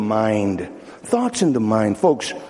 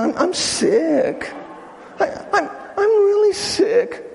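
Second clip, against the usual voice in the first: a hint of a self-pitying invalid; whiny sick voice; 9 semitones higher than usual. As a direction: moan it for sympathy, rising high. Acting someone announcing their illness.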